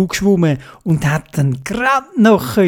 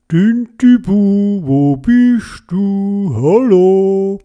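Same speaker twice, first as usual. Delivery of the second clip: slow, high searching call; drawn-out calling; 4 semitones higher, 3 dB louder, much slower than usual. A narrator's reading. Father squid looking for his smallest child, calling out slowly. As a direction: stretch each word as if calling across distance.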